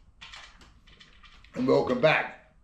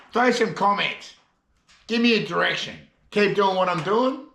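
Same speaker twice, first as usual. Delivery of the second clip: normal talking voice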